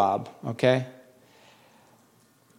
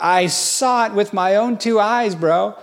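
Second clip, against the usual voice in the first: higher, slow, emphatic disbelief; mildly hammed-up astonishment; retold amazement; 6 semitones higher, slower than usual. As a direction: draw it out as a stunned eyewitness, imitated. Retold stunned reply to the boss.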